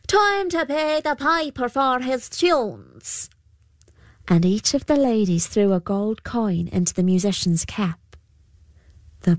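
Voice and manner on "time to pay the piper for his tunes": higher, slow trickster call; Púca calling out. A narrator's reading. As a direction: announce it like a sly fairy. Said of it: "The Púca called out".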